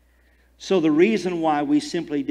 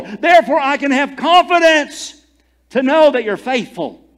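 loud, higher preaching at full force; 8 semitones higher, 9 dB louder than usual. Acted. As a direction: preach it out with fervour.

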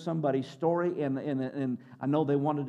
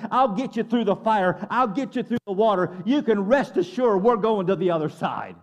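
loud, raised-pitch sermon climax; full-force preaching; 4 semitones higher, 7 dB louder than usual. Acted. Preacher rallying the congregation on God's faithfulness, forceful and loud. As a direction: drive the promise home, pounding each phrase.

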